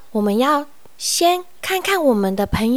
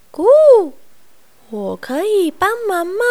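owl hoot; 8 semitones higher than usual. Acted. Owl hoots.

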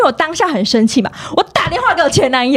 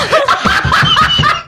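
laugh. Real, not acted. Laughs at mocking comment.

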